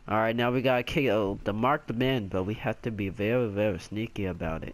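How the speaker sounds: low conspiratorial voice; sneaky plotting. Pretend. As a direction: drop low, share a secret plan.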